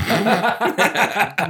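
big high laugh breaking out. Real, not acted. Laughing at his own naked story. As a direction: burst out laughing at himself.

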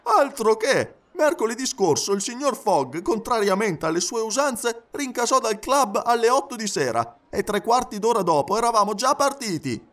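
high, emphatic valet's voice; animated high-pitched gossip; talkative Passepartout, excited. Acted. Passepartout tells of sudden departure.

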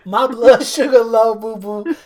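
playful mock diabetic; laughing diabetic whine. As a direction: giggle out the blood sugar line. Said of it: "the way you fucking said it".

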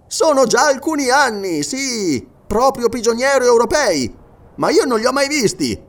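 high, projected character voice; emphatic sergeant, pitched above the narration; sergeant recalling. Acted. Sergeant's dialogue.

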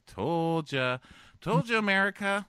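high, loud mock gloating; smug crowing. Man gloating to America after the breakup, smug. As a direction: toss it out as an I-told-you-so. Acted smug aside with a shrug.

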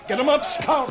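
high, loud charging cry; battle shout. Acted. Tonto shouting as he rides out on Scout. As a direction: yell as you spur into the charge.

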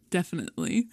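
hushed, conspiratorial retold answer; mock whispered reply. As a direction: drop it softly, as if confiding. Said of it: "lean over to the other and whisper".

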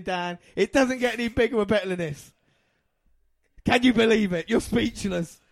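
high, loud and elated, easing gradually from a shout; emotional celebration. Real, not acted. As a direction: exult over a long-awaited title win. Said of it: "so much emotion in my voice".

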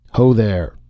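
called out into the dark; calling out. A narrator's reading. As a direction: call out from the porch. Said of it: "a male voice called experimentally".

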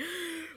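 sharp theatrical gasp; a hammed-up intake of breath; mock gasp. Playing a Scorpio who doesn't cry, mock gasp.

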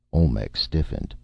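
ordinary delivery, nothing marked

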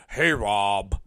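low, dopey mimicry; mocking employee impression. Unreliable employee greeting his boss, low mocking voice. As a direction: imitate a sheepish caller, voice dropped low. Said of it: "He goes".

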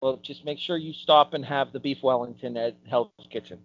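ordinary talk, nothing marked

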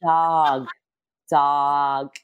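drawn-out, low careful words; slow talking. Retold or imitated. Game show host reading clues carefully, slow talking. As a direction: stretch each word out slowly. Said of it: "You see me talking so slow".

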